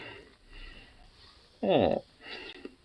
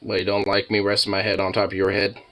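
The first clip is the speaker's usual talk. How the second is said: ordinary delivery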